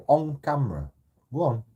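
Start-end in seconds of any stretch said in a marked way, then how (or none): none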